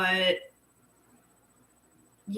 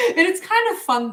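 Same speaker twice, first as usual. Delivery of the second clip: giggly; 12 semitones higher, 5 dB louder than usual. Real, not acted. Giggling at guides.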